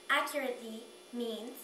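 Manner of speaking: normal talking voice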